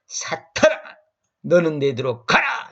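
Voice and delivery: a stern Jesus crying out to Satan; stern rebuke. Retold or imitated. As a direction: rebuke him with full force. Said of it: "이렇게 합니다".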